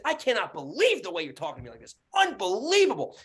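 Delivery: indignant disbelief, rising high; retold outrage peaking on the last word; pissed off. Landlord retells outrage at tenant's tone, angry disbelief. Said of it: "And now I'm fucking pissed".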